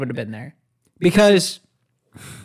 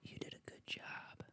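breathy whisper in the ear; right in your ear; 31 dB quieter than usual. Retold or imitated. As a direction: whisper it close and intimate. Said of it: "Whisper in your ear".

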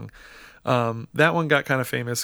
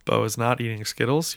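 ordinary talk, nothing marked

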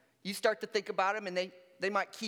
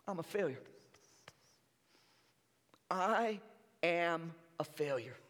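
defeated confession; 4 semitones lower, 6 dB quieter than usual. Acted. Tempted believer admitting failure, soft and heavy. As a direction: let the words sink in despair.

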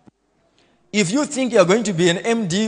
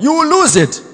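much higher and louder, hammered home; sharp warning shout; 11 semitones higher, 7 dB louder than usual. Acted. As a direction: shout the verdict at the congregation. Warning lazy workers in sermon.

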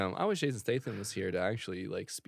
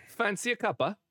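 much higher cheeky Cockney offer; mock British accent; 9 semitones higher than usual. Offering tea to friends in mock British. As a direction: sing it out like a tea lady.

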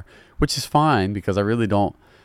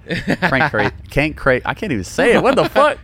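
laughing; 8 semitones higher, 4 dB louder than usual. Real, not acted. Tongue-tied, laughing at himself.

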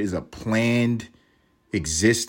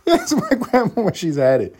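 said through laughter, pitched way up; giggling, pitched high; laughing; 12 semitones higher, 5 dB louder than usual. Real, not acted. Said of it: "laughing high repeat".

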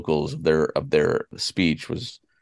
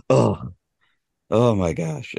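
mildly exasperated retelling, pitched up a little; 5 semitones higher, slower than usual. Retold or imitated. Boss from Danvers challenged on her wording, mildly exasperated. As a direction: imitate a boss fed up with being corrected. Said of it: "It's just exasperated".

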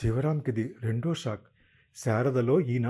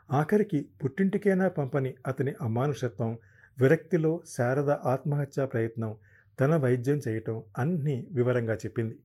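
ordinary delivery

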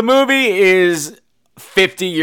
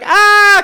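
high mock shriek; sudden high, loud "ah"; mock scream; 6 dB louder than usual. Mimics mother's scream.

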